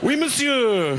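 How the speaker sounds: laughing, hammed-up "Oui, monsieur"; playful French flourish; mock French. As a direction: toss off a cheeky French reply.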